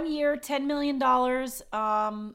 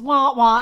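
mock sad trombone; 10 dB louder than usual.

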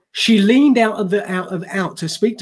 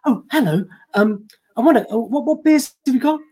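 a light posh English Hugh Grant voice, higher at times; Hugh Grant impression; 5 semitones higher than usual. English tourist ordering beers at a Californian bar in a light Hugh Grant voice. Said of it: "I went full Hugh Grant".

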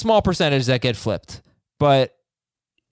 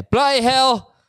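high, hammed-up British exclamation; mock British accent; 8 semitones higher, slower than usual. Host closing the trade question, mock British exclamation. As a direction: pitch it up in fake British. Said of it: "I just heard all those British accents".

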